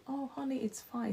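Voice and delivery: softly imitating a calm husband. Husband soothing his unfaithful wife, mock calm. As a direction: hush it, gentle and unbothered. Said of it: "And the man is so calm".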